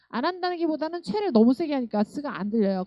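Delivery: ordinary talk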